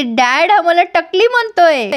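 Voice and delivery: high, drawn-out complaint; mock wailing yell. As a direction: cry out to dad, hammed-up. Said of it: "ती पुढं ओरडते".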